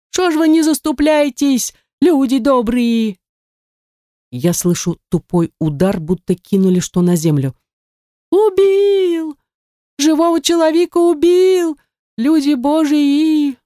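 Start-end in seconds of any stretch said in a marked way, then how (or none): 0.1-3.2 desperate plea. A narrator's reading. Victim begs bystanders. 8.3-13.6 high, piercing cry of horror; a woman's high wail over a killing; horrified scream. A narrator's reading.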